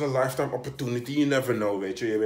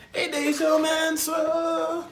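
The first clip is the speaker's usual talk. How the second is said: very slow, higher drawn-out sigh at these people; mock exasperation; 10 semitones higher, 7 dB louder, much slower than usual. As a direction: stretch it out in disbelief. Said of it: "maar nu is het".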